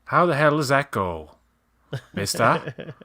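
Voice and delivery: put-on old-timey Mid-Atlantic talk; lightly voiced lockjaw old-timer; Mid-Atlantic accent. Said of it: "Mid-Atlantic is more like this".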